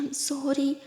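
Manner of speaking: whispered sorry; soft apology. Acted. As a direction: whisper it gently. Holding her friend's shaking hand. (धीरे से फुसफुसाते हुए माफ़ी)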